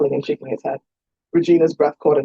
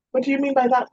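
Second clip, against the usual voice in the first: Elodia's voice; 10 semitones higher than usual. A narrator's reading. Elodia questions her father.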